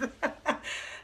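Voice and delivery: high breathy burst of laughter; short laugh. Real, not acted. Comic laughing at a Facebook message, amused laugh. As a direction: laugh it off briefly.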